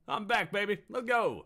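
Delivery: high, loud, hyped-up cheer; excited. Real, not acted. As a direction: celebrate coming back on air. Excited return.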